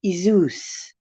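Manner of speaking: Isus said EE-zus with a falling tune; Isus, EE-zus, read slowly; old spelling Isus. Shown as a demonstration. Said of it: "actually spelled with an I".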